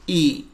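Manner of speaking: short, even ई, no jerk or stretch; correct short ee. Shown as a demonstration. Teacher shows the right ई to students, short and even. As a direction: say ई short and steady. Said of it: "इसको ई पढ़ेंगे".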